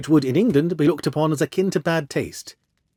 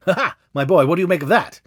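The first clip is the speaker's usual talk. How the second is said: lively, higher exclamation with a laugh; triumphant; 6 semitones higher, 5 dB louder than usual. A narrator's reading. Holmes to his friend over a clue, triumphant. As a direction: crow over a clever discovery.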